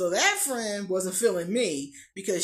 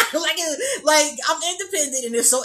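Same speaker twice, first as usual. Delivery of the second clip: high, loud laughing words; 8 semitones higher, 12 dB louder than usual. Real, not acted. Laughing about her independence.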